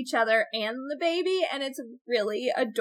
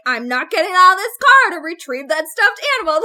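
mock quarrel; 4 semitones higher, 10 dB louder than usual. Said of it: "And it's like".

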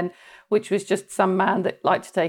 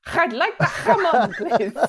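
put-on high-pitched Spanish accent breaking into laughter; hammed-up Spanish with throaty h; 6 semitones higher than usual. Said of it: "I'm better at Spanish".

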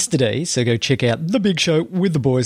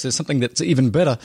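ordinary talk

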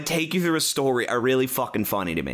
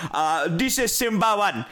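put-on higher radio voice with heavy African accent; 5 semitones higher than usual. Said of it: "very heavy African accented guy".

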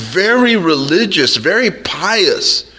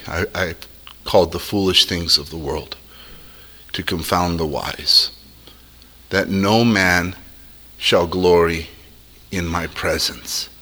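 solemn God; 10 semitones lower, 5 dB quieter than usual. Retold or imitated. Voicing God, low and soft.

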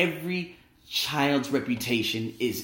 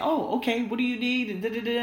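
high, sing-song nurse greeting; 10 semitones higher than usual. Retold or imitated. The nurse greeting him at check-in, high and chirpy.

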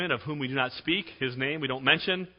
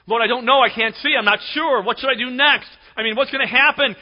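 anxious pleading; 9 semitones higher, 8 dB louder than usual. Acted. Anxious believer pleading with God.

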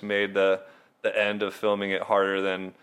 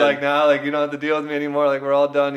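sarcastic; 6 semitones higher, 7 dB louder, faster than usual. Retold or imitated. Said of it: "a sarcastic comment where I'm like".

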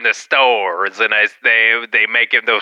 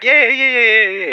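much higher, repetitive yeses; imitated sandwich worker; 10 semitones higher than usual. Sandwich worker agreeing with a customer, imitated high voice. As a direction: agree over and over in a high mock voice.

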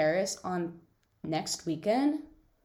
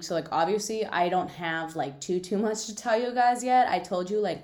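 usual speaking voice

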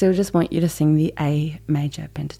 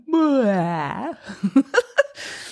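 exaggerated open sob, breaking into a laugh; big sob sound; 6 semitones higher than usual. Shown as a demonstration. Singing teacher demonstrating sob quality, big sob sound. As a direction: wail a big mock sob, then laugh. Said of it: "give me that big sob sound".

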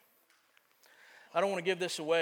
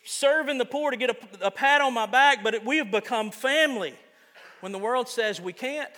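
loud, emphatic sermon delivery; forceful preaching; 7 semitones higher, 9 dB louder than usual. Real, not acted. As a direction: preach it out with conviction.

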